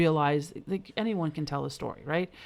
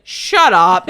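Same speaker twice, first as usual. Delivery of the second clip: much louder playful snap; mock snap; 3 semitones higher, 17 dB louder than usual. Grandma snapping at her grandson's soup joke, loud. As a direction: bark it back in fun.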